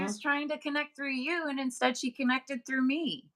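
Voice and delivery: high, wide-eyed revelation; light up with the sudden connection; excited realization. Retold or imitated. Telling mom the spirit reached out to her first, excited. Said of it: "And I was like".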